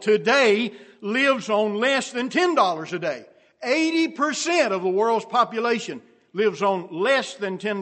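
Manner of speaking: loud, high-pitched sermon delivery; full-force preaching. Real, not acted. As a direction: hammer each figure home to the congregation.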